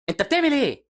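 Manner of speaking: very high yelled question; alarmed yell. Acted. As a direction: shout in disbelief at the driver. Yelling out window at reckless driver.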